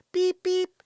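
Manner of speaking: high, loud mock beeping; imitated horn beeps. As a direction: beep like a little trolley horn.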